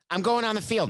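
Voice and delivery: high-pitched defiant declaration; insist, voice jumping high; emphatic insistence. Acted. Player arguing past security to reach the field, emphatic. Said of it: "Let's see how that would go".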